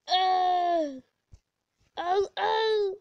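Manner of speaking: loud, high bursts of oh; startled cries. Real, not acted. Gamer mid-mishap, loud startled cries. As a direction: yelp each oh loudly.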